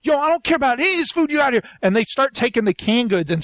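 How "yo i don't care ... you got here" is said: fast, higher-pitched irritated outburst; imitating a fed-up hothead; mock angry dismissal. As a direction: rattle off a put-on angry rejection. Acted angry rejection of food.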